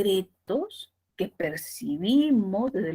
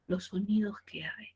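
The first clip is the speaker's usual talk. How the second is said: whisper; 8 dB quieter than usual. Shown as a demonstration. Susurro didáctico.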